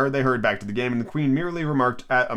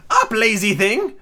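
Queen voice; 10 semitones higher, 4 dB louder, slower than usual. Acted. Queen voiced in read story.

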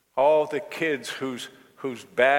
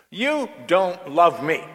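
put-on higher and louder, a wounded childish cry; angry teen accusation; 6 semitones higher, 7 dB louder than usual. Daughter shouting accusingly at her mother, higher and louder. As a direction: accuse her with hurt and anger.

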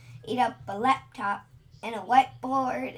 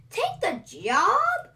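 higher, louder, slow disbelieving question; astonished question; 9 semitones higher, 6 dB louder, slower than usual. A narrator's reading. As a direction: drag it out in wonder. Dragon astonished at news.

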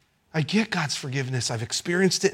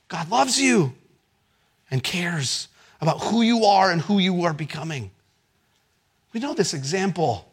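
ordinary talk

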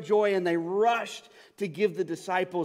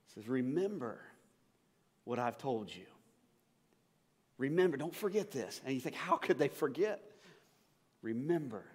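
quiet reflective sermon; 6 semitones lower, 8 dB quieter than usual. Acted. Preacher quietly reflecting on the angel's words, soft and low. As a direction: draw them in with hushed words.

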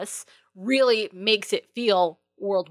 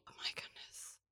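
whisper; 26 dB quieter than usual. Real, not acted. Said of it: "whispered aside".

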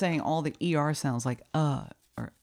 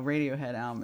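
ordinary talk, nothing marked

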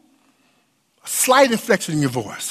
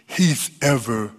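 hushed preaching; 5 semitones lower than usual. Acted. Preacher drops to intimate reflection.

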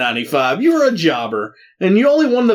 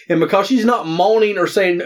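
ordinary talk

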